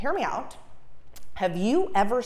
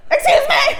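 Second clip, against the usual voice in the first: yelled; 11 dB louder than usual. Retold or imitated. Yelling at a man grabbing her umbrella. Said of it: "A little better than".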